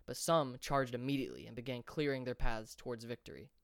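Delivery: plain reading voice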